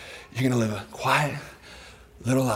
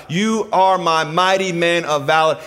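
slow, raised, emphatic proclamation; God's declaration; 5 semitones higher, 5 dB louder, slower than usual. Acted. God naming Gideon in this moment, proclaiming. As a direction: declare it word by word, loud and high. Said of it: "he hears the voice of God".

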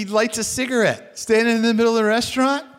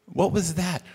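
bewildered; 4 semitones lower, 5 dB quieter, slower than usual. Retold or imitated. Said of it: "and so I'm like".

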